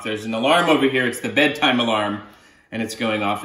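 ordinary delivery

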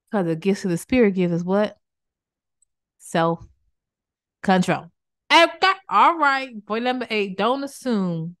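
ordinary talk, nothing marked

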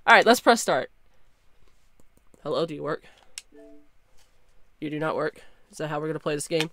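usual speaking voice

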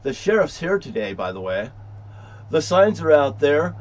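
usual speaking voice

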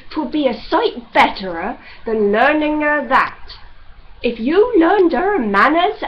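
loud, peevish scolding; petulant. Acted. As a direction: grumble crossly at his wife. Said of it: "he said pettishly to his wife".

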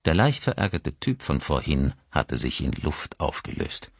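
plain reading voice

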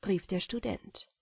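plain reading voice

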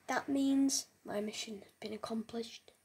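hushed, conspiratorial whisper of the plan; secretive whisper. Acted. Gamer whispers his secret mission to viewers, conspiratorial whisper.

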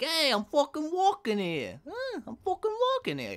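high, loud Brooklyn tough guy; Brooklyn accent. Pretend. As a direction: yell it like a Brooklyn cabbie. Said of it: "I can do Brooklyn".

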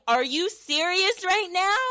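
high-pitched exasperated question; incredulous outrage. Retold or imitated. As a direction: demand it in hammed-up disbelief. Retold outrage at the snorer.

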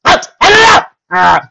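high, loud repeated shouts; shouting. Acted. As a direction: chase him out, yelling each word. Chasing someone out.

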